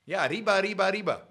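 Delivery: a light mock Speedy Gonzalez cry; Speedy Gonzalez catchphrase. Host quoting the cartoon mouse, lightly mock Mexican accent. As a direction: toss off arriba like the cartoon mouse. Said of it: "Speedy Gonzalez perpetuated".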